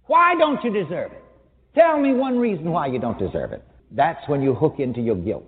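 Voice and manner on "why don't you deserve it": ordinary delivery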